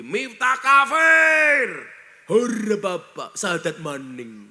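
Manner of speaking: slow, loud jeering at the preacher; mock bullies' shout. Online critics jeering at the preacher for preaching in church, loud mocking shout. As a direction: shout it like an angry online mob.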